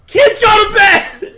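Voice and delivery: high, drawn-out shouted order; yelling parent. Pretend. Parent sending kids to bed, loud mock shout. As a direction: holler it slowly up the stairs.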